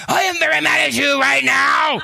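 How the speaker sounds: put-on high-pitched little boy's angry scream; shrill screamed tantrum; screaming, crying child. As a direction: scream it like a furious, pinned-down kid. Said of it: "He was screaming. He was crying".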